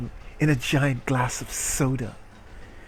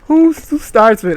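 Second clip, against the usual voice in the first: higher and louder, disbelieving; incredulous; 9 semitones higher, 9 dB louder than usual. Real, not acted. Streamer mocking the heroine's line, loud disbelief. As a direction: ask it in mock outrage.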